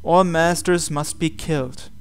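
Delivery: ordinary delivery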